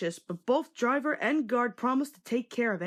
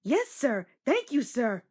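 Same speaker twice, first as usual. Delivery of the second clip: happy; 3 semitones higher than usual. A narrator's reading. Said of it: "said Percy happily".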